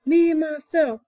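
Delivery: high, proud roared boast; chest-out lion roar. A narrator's reading. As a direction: roar it out like a proud king of beasts. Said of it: "so he roars".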